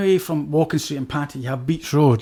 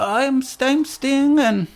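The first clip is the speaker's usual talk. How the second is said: higher, put-on Swedish accent, broken English; bad Swedish accent; 9 semitones higher, much slower than usual. Retelling prison cellmate's words in mock Swedish accent. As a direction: mimic a Swedish cellmate badly. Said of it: "it's not good Swedish accent".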